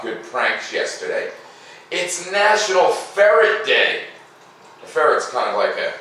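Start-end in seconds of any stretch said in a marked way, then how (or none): 1.9-4.0 big announcement. Acted. Announces the day.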